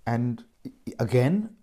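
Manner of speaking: ordinary talk, nothing marked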